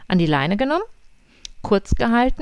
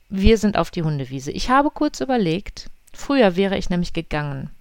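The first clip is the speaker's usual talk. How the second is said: ordinary talk, nothing marked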